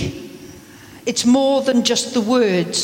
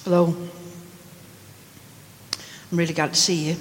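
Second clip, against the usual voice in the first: low and much softer, dismissive; a cold, indifferent greeting; flat, uncaring hello; 8 semitones lower, 6 dB quieter, much faster than usual. Shown as a demonstration. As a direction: say it like you couldn't care less. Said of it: "What do you hear me say?".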